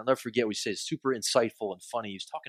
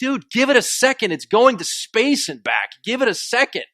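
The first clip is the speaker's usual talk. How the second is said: exasperated scolding; 10 semitones higher, 7 dB louder than usual. Retold or imitated. Comedian rebuking an impatient phone user, exasperated and loud. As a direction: retell the comedian's disbelieving rebuke.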